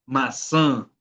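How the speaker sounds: "maçã" with a nasalised final vowel; nasal vowel. Shown as a demonstration. Teacher giving an example of the tilde, nasal ending on "maçã". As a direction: let the end of "maçã" ring through the nose. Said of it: "con un sonido nasal".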